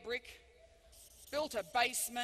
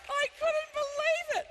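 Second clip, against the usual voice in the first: high, loud, slow through laughter; laughing disbelief; 9 dB louder than usual. Real, not acted. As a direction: squeeze it out through the giggles. Still laughing hard.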